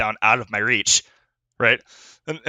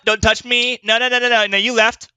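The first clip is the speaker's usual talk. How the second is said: high huffy refusal; butthurt cat; 10 semitones higher, 4 dB louder than usual. Retold or imitated. Ignored cat scolding its returning owner, high and huffy. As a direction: play the sulky offended cat. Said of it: "They get all butthurt".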